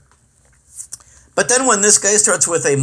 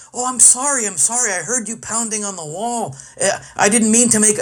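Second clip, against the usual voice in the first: higher, hushed imitation of a timid apology; meek apologetic neighbor; 5 semitones higher than usual. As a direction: play the sheepish neighbor saying sorry. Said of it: "he was all like".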